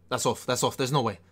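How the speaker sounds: usual speaking voice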